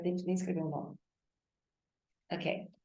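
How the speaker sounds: normal talking voice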